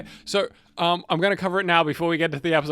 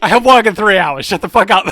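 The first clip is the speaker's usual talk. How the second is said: loud, raised-pitch mock rant; exasperated shout; 5 semitones higher, 12 dB louder than usual. Telling a distracting friend about work soon, exasperated shouting. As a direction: yell over them, much louder and higher.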